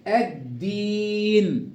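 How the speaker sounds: الدين slowly, doubled d and long ee; lam merged into d. Shown as a demonstration. Teacher shows the merging, slow doubled d. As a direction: stretch الدين with the lam silent. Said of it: "أضغمت اللام في الدال".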